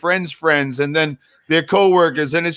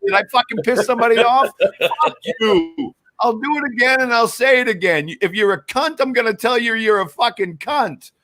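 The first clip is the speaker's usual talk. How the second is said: angry; 7 semitones higher than usual. Real, not acted. Ranting at other creators.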